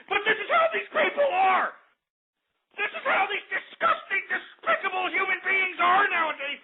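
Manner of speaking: high, strained outraged rant; near-tearful indignation; cracking rant. Acted. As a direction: voice breaking with outrage. Host ranting, voice cracking high.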